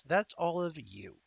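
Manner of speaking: ordinary talk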